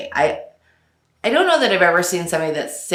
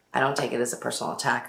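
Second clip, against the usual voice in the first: ordinary talk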